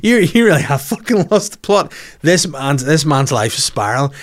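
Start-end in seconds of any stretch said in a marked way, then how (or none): 0.0-1.9 high, disbelieving yelp of a mate; mate telling him he's lost it, high and incredulous; incredulous outburst. Retold or imitated. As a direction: play a shocked friend, pitched way up. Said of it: "And he's like".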